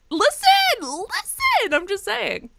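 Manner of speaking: very high, drawn-out squeal; comic protest. Real, not acted. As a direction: plead loudly in a squeaky high voice. Comic self-defence of her joke.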